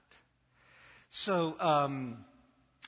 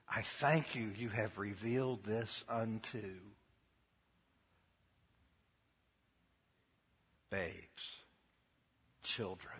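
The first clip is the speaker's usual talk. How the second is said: tender prayer; 6 semitones lower, 6 dB quieter than usual. Retold or imitated. Real Jesus quote, softer.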